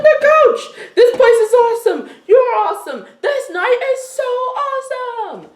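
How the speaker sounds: giddy high-pitched stoner awe; stoned and blissed out. Acted. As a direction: marvel at everything like you're high. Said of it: "She's like".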